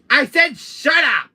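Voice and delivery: high, loud, slow yell; exasperated full-voice yell; angry shout. Acted. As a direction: shout them down, leaning on each word. Yelling at the voice to stop.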